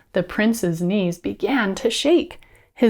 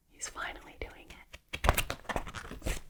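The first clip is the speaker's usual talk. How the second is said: hushed, gasping whisper; 16 dB quieter, slower than usual. Pretend. Storyteller to children as the prince leans in, excited whisper. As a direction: whisper it in suspense. Hushed aside as the kiss nears.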